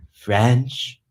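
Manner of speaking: "French" said the French way, with a nasal vowel and a rising end; French pronunciation. Shown as a demonstration. Asked by a viewer how she says French, a questioning French "French". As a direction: say "French" as a French speaker would. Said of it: "How do you say French?".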